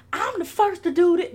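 high, loud boast. Retold or imitated. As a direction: proclaim it like an indignant rapper. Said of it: "I'm about to start being [name]".

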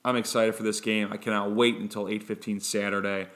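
ordinary talk

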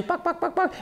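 high, soft clucking buck, buck, buck; quiet high hen clucks. Retold or imitated. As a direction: cluck softly like a pecking hen. Chicks pecking around too.

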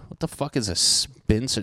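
usual speaking voice

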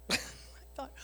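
usual speaking voice

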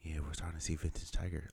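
quiet golf announcer voice; hushed vintage Tiger call; golf commentator. Retold or imitated. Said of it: "P G A Tour whisper".

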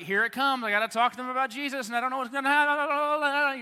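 high, loud nervous panic, racing and building; super nervous. Acted. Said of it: "you get super nervous".

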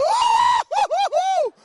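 very high, very loud goal whoops; celebrating yell. Retold or imitated. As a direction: whoop like a grown man scoring a goal. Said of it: "Ele grita". (gritos de gol, muito agudos e muito altos)

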